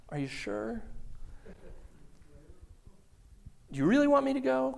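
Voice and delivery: high, hesitant questioning voice; doubting believer. Acted. A doubting believer questioning God, high and hesitant. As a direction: ask God nervously, stalling.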